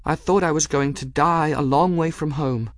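high voice, acted as close to tears; tearful. A narrator's reading. Girl crying to the cat after the battle, tearful. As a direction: let it out high and upset. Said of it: "she did begin to cry".

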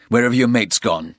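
loud, hard demand; angry demand. A narrator's reading. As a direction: press him, furious. Porter demands.

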